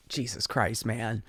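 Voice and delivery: soft, low disbelieving sigh; exasperated mutter. Real, not acted. Exasperated aside.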